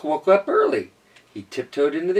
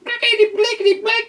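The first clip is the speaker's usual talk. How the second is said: squabbling gibberish; 6 dB louder, faster than usual. Acted. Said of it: "they're like".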